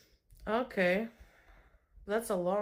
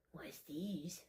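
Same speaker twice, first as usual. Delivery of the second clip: secretive whisper to the cat; 3 semitones lower, 13 dB quieter than usual. Acted. Owner whispering teasingly to her cat. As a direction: drop to a conspiratorial whisper.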